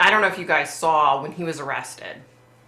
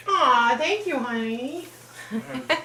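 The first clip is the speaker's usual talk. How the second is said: touched thanks; 8 semitones higher, slower than usual. Real, not acted. Viewer compliments her earrings.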